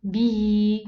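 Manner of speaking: Bihi loud and measured, final ii held long; small-ya demonstration. Shown as a demonstration. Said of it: "pronounce it as exactly like this".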